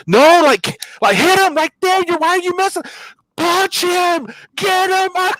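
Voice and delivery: high, loud egging on; wife yelling at the TV. Retold or imitated. Wife cheering on a UFC fight from her hospital bed, high excited yelling. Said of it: "she's like".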